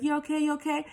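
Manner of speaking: imitating his put-on concern; mock concerned guy. A man asking the stumbling narrator with mock concern. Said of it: "he appeared very concerned".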